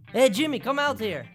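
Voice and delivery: calling out for the assistant. Acted. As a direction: summon the assistant from afar.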